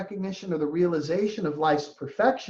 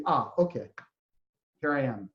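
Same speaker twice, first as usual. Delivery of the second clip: calm acceptance; 8 semitones lower, 5 dB quieter than usual. Pretend. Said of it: "you can just be".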